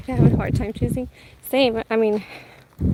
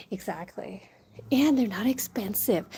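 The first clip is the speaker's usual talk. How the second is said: whispering; 4 dB quieter than usual. Real, not acted. Whispered aside.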